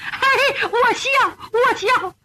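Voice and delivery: high, quavering voice breaking into a wail; tearful joy. Acted. Mother asked by returned son to smile, tearful joy. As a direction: try to laugh and end up wailing.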